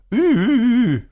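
high, loud hooting "ü-ü-ü"; imitated siren noise. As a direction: mimic a strange car siren in repeated high bursts. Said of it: "irgendwie ganz komisches Geräusch". (hohes, lautes Sirenengeräusch nachgemacht)

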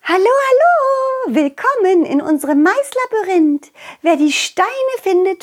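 high, bright little fairy voice; chirpy girl welcoming visitors; cheerful butterfly girl. Acted. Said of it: "Schmetterlingmädchen ... grüßte fröhlich".

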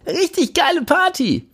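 put-on very high, slurred party drunk; a wasted guy slurring with glee. A narrator's reading. Jimmy to narrator, slurring drunk and high-pitched. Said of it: "wiederholt er".

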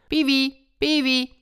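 high calling voice; farmer calling. Acted. Farmer calling his peacock, high call. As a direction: call out to the bird from afar. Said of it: "wenn der Bauer ihn rief".

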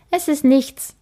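put-on child quickly insisting; quick denial. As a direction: hurry the denial. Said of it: "sagt Kim schnell".

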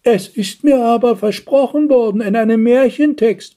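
put-on high-pitched old lady's protest; aged, protesting. A narrator's reading. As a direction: object like an elderly fairy-tale princess. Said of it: "widersprach das betagte Dornröschen". (betagtes Dornröschen, hoch und protestierend)

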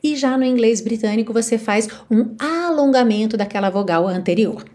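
usual speaking voice

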